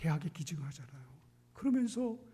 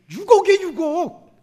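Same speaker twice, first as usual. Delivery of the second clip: loud, slow, drawn-out gasp at the sum; mock astonishment; 10 semitones higher, 15 dB louder, slower than usual. Shown as a demonstration. Pastor to congregation, demonstrating surprise: hammed-up astonishment. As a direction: act shocked for the crowd. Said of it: "좀 놀래 좀 보세요".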